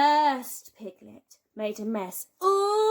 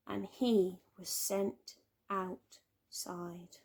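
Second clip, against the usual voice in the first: slow sad whisper; 3 semitones lower, 7 dB quieter, much slower than usual. A narrator's reading. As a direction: let it fall to a hush.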